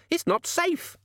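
high, slow panicked scream. Acted. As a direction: shriek it at him. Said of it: "she screamed".

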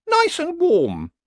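very high, slow horse voice; nervously pleading horse. Acted. Uneasy horse pleads to retire, high and slow.

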